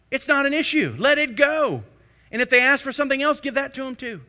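loud, high, driving sermon voice; full-force preaching. Acted. Pastor urging the church to let it go, preaching hard. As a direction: preach it out to the congregation.

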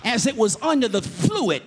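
loud, ringing sermon cadence; loud preaching with music behind; full-force preaching. Acted. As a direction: preach it out to the whole church.

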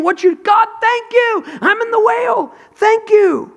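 very high, loud cry of praise; Jonah shouting thanks. Acted. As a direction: shout gratitude up from the whale's belly. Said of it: "He's saying".

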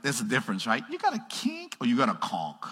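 ordinary talk, nothing marked

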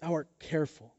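caring, soft answer with long pauses; Jesus. Acted. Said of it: "how caring Jesus would have spoken".

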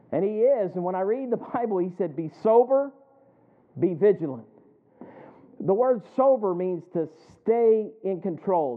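loud, rising pulpit cadence; preaching at full force. Acted. Pastor warning the congregation about Satan, loud preaching. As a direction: drive it home to the congregation.

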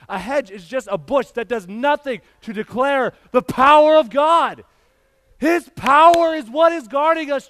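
loud, rising preacher's cry; full-force preaching. Acted. Pastor driving home God's power to church, loud preaching. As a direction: preach it out to the back row.

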